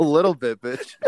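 words broken by real laughter; laughing. Real, not acted. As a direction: laugh while saying it. Laughing at friend's giggle fit.

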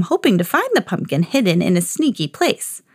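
plain reading voice

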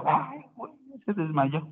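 hushed, mildly startled aside; mock startled reaction. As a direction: act out a bystander's quiet surprise. Acting out someone seeing a faint.

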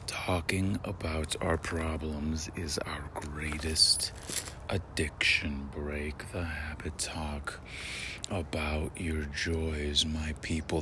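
slow, soft, husky-sexy whisper. Shown as a demonstration. Rereading the quote to viewers, slow and sultry. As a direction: seduce them with the quote, slowly. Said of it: "a little slower and a little sexier".

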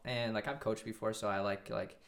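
usual speaking voice

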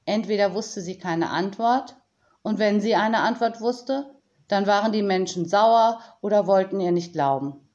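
ordinary delivery

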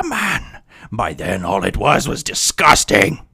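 booming parody villain monologue; mock movie villain speech. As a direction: declaim it like a grandiose movie villain.

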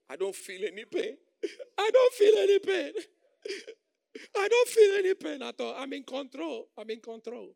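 high, loud laughing-crying pretense; mock brave denial. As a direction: fake being fine through tears. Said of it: "Say, How are you?".

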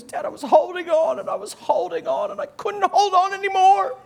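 high, loud upset-kid imitation; mad, yelling child. Son yelling at dad after falling off tube, mock upset. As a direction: mimic a son protesting at the top of his voice. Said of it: "he's so mad at me".

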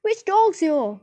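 high, forceful answering yell; shouting back. A narrator's reading. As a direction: shout it back across the road. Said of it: "shouted back Metal".

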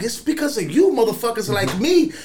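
loud, high, escalating rant; a furious rant with a laugh in it; going off. Retold or imitated. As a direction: let him have it, building up. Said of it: "I went off".

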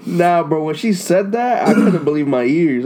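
high, loud, worked up; excited. Real, not acted. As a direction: tell it all fired up. Excited retelling.

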